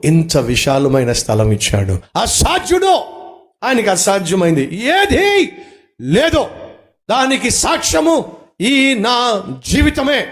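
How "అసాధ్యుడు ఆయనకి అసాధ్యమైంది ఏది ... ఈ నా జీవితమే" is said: high, slow proclamation; preaching at full force. Acted. Pastor testifying to the church, preaching at full force. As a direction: proclaim it as your own testimony.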